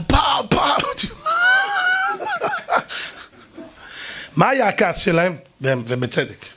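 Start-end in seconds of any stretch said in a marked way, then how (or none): 0.1-3.1 high mouthed party blast; imitated blaring music. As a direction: thump out beats then a long high squeal. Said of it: "אני שומע". (חיקוי בפה של מוזיקה רועשת)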